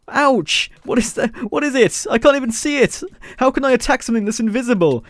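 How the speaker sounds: high-pitched, baffled alarm. Real, not acted. Gamer hit by an invisible attacker, alarmed. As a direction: yelp and question in rising panic.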